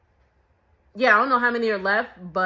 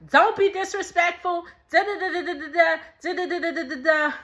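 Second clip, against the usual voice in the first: high mimicked telling-off trailing into rattled-off da-da-das; mock an offended mother in a high voice; mock scolding; 7 semitones higher than usual. Vlogger imitating the offended mom's rant, mock scolding. Mocking Susan's overreaction to "hold on.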